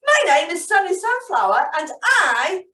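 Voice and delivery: lightly voiced happy sunflower; Sunny Sunflower voice. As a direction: play a perky little sunflower. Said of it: "said the sunflower".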